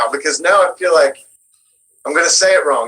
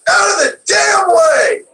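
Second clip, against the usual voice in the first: slow, much higher shouted bark; John-style yell; slower than usual. Retold or imitated. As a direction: yell it like a frustrated cowboy shoving past. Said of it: "John yells a lot".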